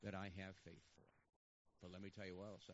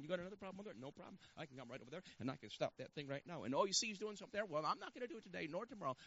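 higher, louder and faster as the fires pile up; hurried, overworked God; 8 semitones higher, 10 dB louder than usual. Acted. Preacher mimics how people see God fighting fires, mounting frantic bluster. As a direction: build from casual confidence to a frantic boast. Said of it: "this is how we see God".

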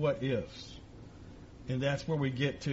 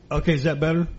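projecting louder so the room hears; louder and clearer; 8 dB louder, much faster than usual. Real, not acted. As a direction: push the voice out louder. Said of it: "let me try it like this".

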